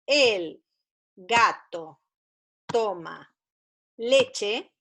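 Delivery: "El gato toma leche" with pauses between the words, each word set apart; teacher reading a first sentence aloud for children, in slow dictation. Shown as a demonstration. Word by word, like reading to a beginner. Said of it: "como por ejemplo".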